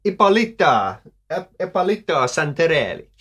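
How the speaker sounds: theatrical mock Italian; Italian woman's accent. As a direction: announce the name like an Italian diva. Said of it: "a beautiful young Italian woman".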